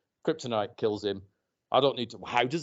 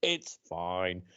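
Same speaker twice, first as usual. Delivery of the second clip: normal talking voice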